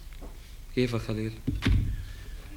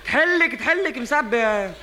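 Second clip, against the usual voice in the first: excited ranting; 7 semitones higher than usual. Acted. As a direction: rant about the tank, voice pitched up. Ranting about water tank, high. (نقّ متحمس بصوت عالٍ ومرتفع)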